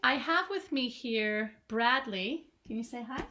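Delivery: plain reading voice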